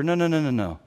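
usual speaking voice

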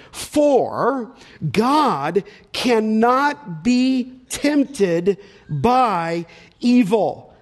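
very slow, each word set apart; emphatic verse reading. Real, not acted. Preacher reads key verse emphatically.

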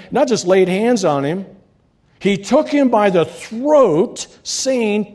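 lifted pulpit reading voice; scripture reading. Acted. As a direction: proclaim the parable to the church. Reading scripture aloud to congregation.

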